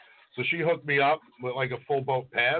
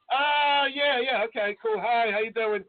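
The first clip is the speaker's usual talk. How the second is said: high, louder fake enthusiasm; awkward politeness; 8 semitones higher, 3 dB louder than usual. Fans meeting the runners-up, high forced cheer. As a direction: brush off the other two with forced cheer. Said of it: "They're like".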